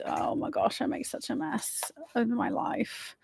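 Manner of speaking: soft self-mocking mutter under the breath; muttering to herself, embarrassed; muttered aside. Real, not acted. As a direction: mumble it to yourself, half laughing at yourself.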